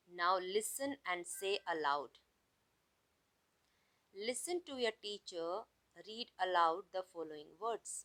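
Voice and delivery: slow, deliberate classroom reading; teacher reading the task aloud; slow instruction read. Shown as a demonstration. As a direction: spell it out slowly for the class. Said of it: "teacher reading instructions".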